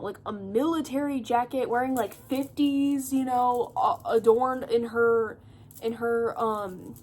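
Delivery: ordinary talk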